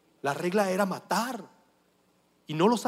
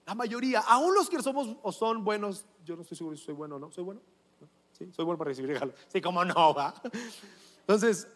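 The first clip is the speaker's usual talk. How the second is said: normal talking voice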